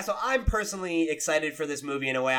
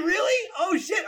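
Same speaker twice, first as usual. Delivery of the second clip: real surprise. Real, not acted. Host stunned by the Mortal Kombat rating, high surprised shout. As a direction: jump up in shock.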